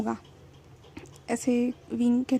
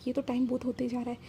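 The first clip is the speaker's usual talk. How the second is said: whisper; 6 dB quieter than usual. Retold or imitated. Wing mutters about the late hour.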